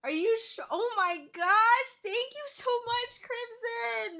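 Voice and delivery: high, overwhelmed squeal of thanks; stunned, drawn-out tearful thanks; tearful gratitude. Real, not acted. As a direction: squeal your thanks while tearing up. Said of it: "I'm actually tearing up".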